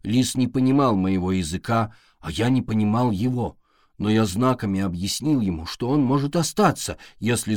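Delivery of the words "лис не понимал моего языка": plain reading voice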